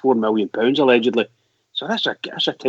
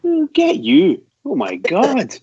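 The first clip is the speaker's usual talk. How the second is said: mock impressed; 9 semitones higher, 4 dB louder, much slower than usual. Real, not acted. Teasing mate's fancy dinner.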